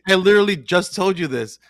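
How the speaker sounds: retold scolding. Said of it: "he was like".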